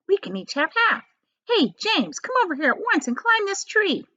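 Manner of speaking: put-on high, mean old aunt; the greedy aunt, pitched high; aunt's voice. Aunt Spiker eyeing the peach, high and greedy.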